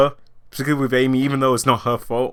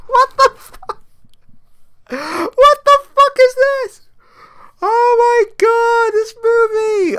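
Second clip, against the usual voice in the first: high, slow, loud incredulous cry; laughing disbelief; 5 dB louder, slower than usual. Retold or imitated. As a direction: wail in horrified amusement. Reacting to porn-star gesture.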